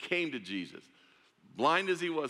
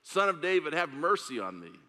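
mock shout; 6 semitones higher, 4 dB louder than usual. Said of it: "he shouted all the more".

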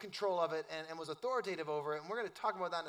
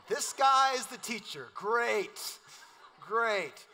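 mock sarcastic; 3 semitones higher, 7 dB louder, much slower than usual. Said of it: "You're like".

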